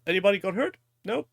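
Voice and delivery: ordinary talk, nothing marked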